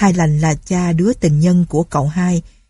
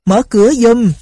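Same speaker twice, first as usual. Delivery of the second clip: higher, louder call through the gate; 5 semitones higher, 5 dB louder than usual. A narrator's reading. Villagers outside the gate calling to be let in, loud call. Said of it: "nhiều người gọi liên tiếp".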